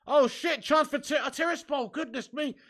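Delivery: sudden high, loud burst of alarm; excited commentary. Real, not acted. Football streamer reacting live to a chance, excited. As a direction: blurt out the live action, high-pitched.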